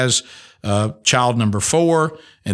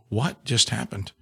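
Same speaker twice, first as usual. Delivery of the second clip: stunned hush; 7 dB quieter than usual. Retold or imitated. Stunned after hearing son drowned.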